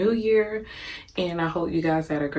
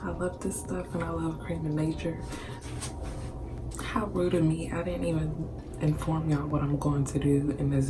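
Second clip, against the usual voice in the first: whispering; 4 dB quieter than usual. Real, not acted. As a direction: keep it to a soft whisper. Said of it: "And I have to whisper".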